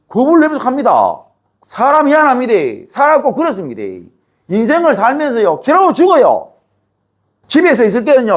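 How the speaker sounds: impassioned preacher, pitch lifted high; fervent preaching. Acted. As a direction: preach it with rising fervour to the congregation. Preacher builds into fervent delivery.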